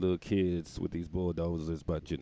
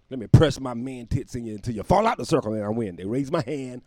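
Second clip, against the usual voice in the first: ordinary talk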